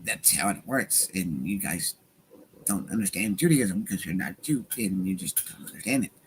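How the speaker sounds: low, soft put-on lecturing voice; Ben Shapiro. Host mimicking a debater explaining Judaism, mock impression. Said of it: "do it in the Ben voice".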